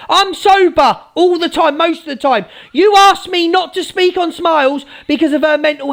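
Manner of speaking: high, heated telling-off; raging. Real, not acted. Telling off the hypocrite Angie, raging. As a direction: tear into them with real fury. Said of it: "I am raging now".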